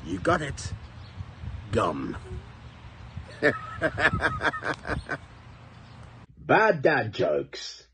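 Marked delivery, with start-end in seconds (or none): 3.2-5.2 airy, higher chuckle; corny dad laugh. Real, not acted. Laughs at own dirty joke. 6.4-7.9 drawn-out title announcement; slow show title. Acted. Title announcement of each joke.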